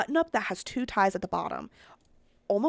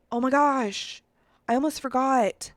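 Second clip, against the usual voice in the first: louder, higher burst of alarm; sudden realisation; 4 semitones higher, 7 dB louder, slower than usual. Real, not acted. To viewers on remembering the bracelet, loud startled exclamation. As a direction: exclaim it as it hits you.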